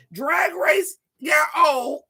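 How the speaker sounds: mock wail. Mock lament about aging out.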